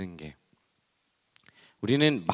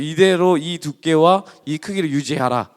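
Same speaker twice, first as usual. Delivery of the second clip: a hint of a bossy wife, slow and loud; wife's stern order; 4 semitones higher, 7 dB louder than usual. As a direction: lay down the rule slowly like a strict wife. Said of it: "그 샘플을 보여줬어요". (단호하게 지시하는 아내 말투)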